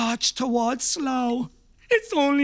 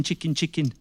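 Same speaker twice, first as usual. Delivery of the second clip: ordinary talk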